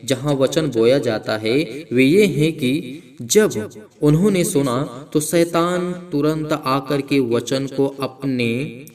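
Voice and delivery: slow, loud, preaching the parable; scripture reading. Acted. Preacher proclaims the sower parable to believers, slow and loud. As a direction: proclaim each phrase slowly and loudly.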